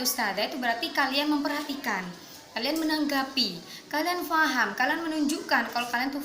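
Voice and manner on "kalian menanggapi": ordinary delivery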